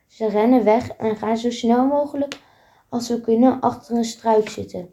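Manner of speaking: plain reading voice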